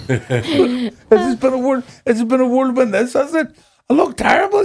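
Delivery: high-pitched comic disbelief; hammed-up indignant squeak; mock incredulous. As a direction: voice jumps up, acting taken aback. Acting out his startled comic reaction.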